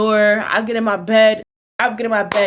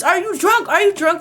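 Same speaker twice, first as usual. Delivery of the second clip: mom yelling; 6 semitones higher than usual. Retold or imitated. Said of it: "She yelling at me".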